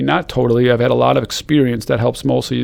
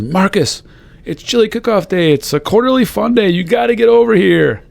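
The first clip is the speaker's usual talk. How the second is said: imitating an upbeat coworker, much higher; bright mock invitation calling across the room; cheery call; 8 semitones higher, 4 dB louder than usual. Jules calling Marcus over to chili day, much higher and peppy.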